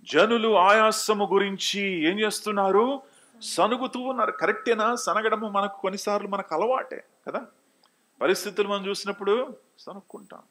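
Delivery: high-pitched preacher driving the verse home. Real, not acted. Preaches with raised voice. (స్వరం పెంచి గట్టిగా బోధ)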